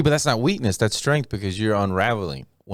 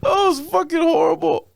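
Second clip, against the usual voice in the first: laughing hard. Real, not acted. Recalling his own bombed set, laughing hard. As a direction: crack up while telling it.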